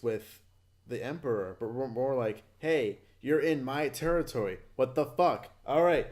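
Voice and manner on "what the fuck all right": ordinary delivery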